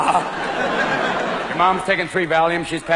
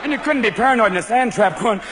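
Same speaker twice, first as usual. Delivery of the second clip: ordinary delivery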